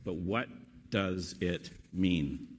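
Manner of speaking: slow, word-by-word question; measured question with pauses, falling on MEAN; slow emphasis. Real, not acted. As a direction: weigh each word of WHAT DOES IT MEAN. Slow emphatic repeat of key question.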